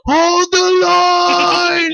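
high, slow, drawn-out rallying yell; heroic battle cry. Acted. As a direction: bellow it like a movie hero charging. Picturing a heroic movie scene.

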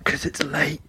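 soft retold whisper; hushed, voiceless explanation. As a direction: whisper the excuse. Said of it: "Why are you whispering?".

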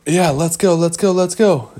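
high, hurried rallying chant; rally everyone to set off; eager urgency. Acted. Boys heading out to save Hook, eager.

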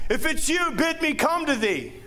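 high mock call across the water; mock yell back. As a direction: call out high and bold as Peter. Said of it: "Peter yells back".

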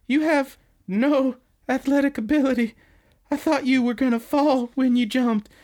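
high, hammed-up tearful wail; squeaky-high pretend sobbing complaint; imitated crying. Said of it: "she's crying pretty hard".

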